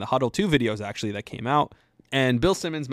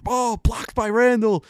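high, loud, drawn-out play-by-play call; big mimicked broadcast exclamation; Mike Breen; 12 semitones higher, 5 dB louder, slower than usual. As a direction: imitate an excited announcer calling a big block. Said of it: "I wish Mike Breen was on the call".

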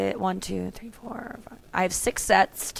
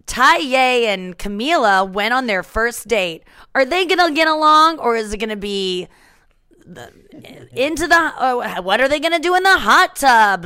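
announcer voice; 4 semitones higher, 5 dB louder than usual. Retold or imitated. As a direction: build the tease like a reality TV narrator. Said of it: "announcer voices".

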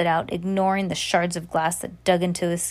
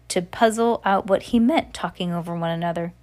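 plain reading voice

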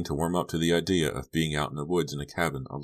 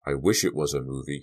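usual speaking voice

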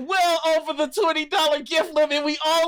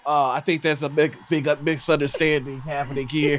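deep voice; 11 semitones lower than usual. Acted. Player reacts in character.

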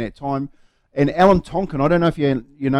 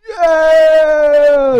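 long, high shouted cheer; mock crowd cheer; 9 dB louder than usual. Retelling how Irish fans greeted an Australian jersey, drawn-out cheering shout. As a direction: holler it out like a roaring pub crowd.

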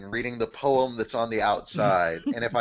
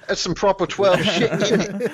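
raised, hammed-up British voice; British accent; 8 semitones higher, 4 dB louder than usual. Host joking about a British TV show, mock British accent. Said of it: "British accents".

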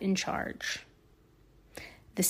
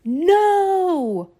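high, loud booming no; 11 semitones higher, 6 dB louder than usual. Acted. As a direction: boom it with fury. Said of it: "boomed the stink bug".

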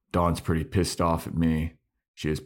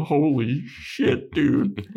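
amazed; 9 semitones higher, much slower than usual. Retold or imitated. Voicing Willow's amazement at magic.

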